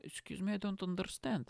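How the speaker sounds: hesitant fake foreigner accent; mock Eastern European accent, faltering; put-on foreign accent. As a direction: play a confused foreigner with a heavy accent. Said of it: "This is how I spoke".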